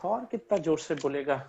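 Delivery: normal talking voice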